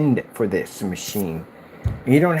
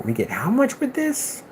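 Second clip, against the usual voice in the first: pitched way up in disbelief; mock disbelief; 11 semitones higher than usual. Ranting at the console price, high incredulous squeak. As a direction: squeak out the question in shock. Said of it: "I'd be ranting".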